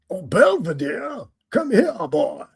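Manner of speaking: Looney Tunes Southern gentleman; cartoon Southern gent. Quoting the cartoon Southern gent calling Belvedere, drawled. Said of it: "Looney Tunes cartoons".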